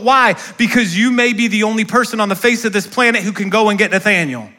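normal talking voice

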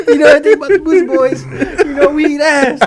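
high, loud, laughing yell; laughing shout. Real, not acted. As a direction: shriek it while cracking up. Echoing boast, cracking up.

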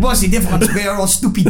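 mock French. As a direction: tease in a hint of a French accent. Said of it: "Said by a French person".

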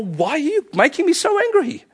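high exasperated question to your soul; mock exasperation. Believer confronting their soul, high and exasperated. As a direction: throw the question up, fed up. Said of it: "you say to your soul".

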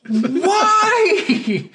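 very high, loud wail with a laugh; mock despairing cry. Player reacting to another disaster, hammed-up despairing wail. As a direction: stretch it into a comic anguished howl.

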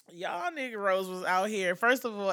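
ordinary talk

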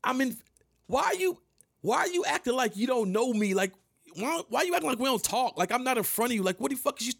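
high, fast indignant yelling; yelling at the rapper for pretending not to know him, high and heated; heated outburst. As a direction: confront her, pitch climbing with outrage. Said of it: "I'm like".

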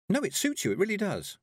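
put-on bright, high-pitched girlish reassurance; cheerful, eager compliment; cheerfully. A narrator's reading. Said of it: "said Tiffany cheerfully".

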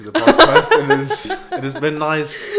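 loud, fast, high sign-off through laughter; real laughter over an announcer outro; laughing sign-off. Real, not acted. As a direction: wrap up while cracking up. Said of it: "ugly laugh".

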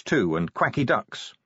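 plain reading voice

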